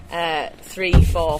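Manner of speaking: ordinary talk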